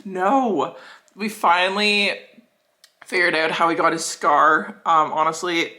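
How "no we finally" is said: usual speaking voice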